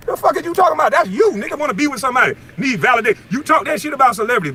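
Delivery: high, heated scorn; heated rant. Real, not acted. Calling out a rival who wants validation, high heated scorn. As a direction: spit it out with contempt.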